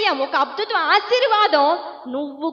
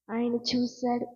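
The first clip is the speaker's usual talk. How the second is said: hushed echo; 6 semitones lower, 9 dB quieter than usual. Shown as a demonstration.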